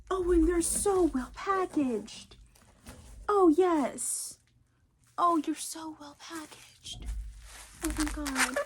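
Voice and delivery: high, slow, fond cooing. Real, not acted. Praising the well-packaged books, cooing fondly. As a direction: coo at the books like a pet.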